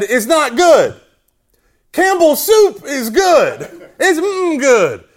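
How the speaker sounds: high, loud, nasal mock pitch; drawn-out, hammed-up whiny announcer. College student giving post-mass announcement, whiny nasal voice. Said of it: "nasally voice".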